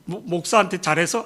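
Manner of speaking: usual speaking voice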